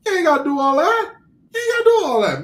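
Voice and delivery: very high, whining objection; pleading protest. Acted. Host telling the rapper it is needless, pleading.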